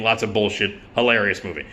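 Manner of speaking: normal talking voice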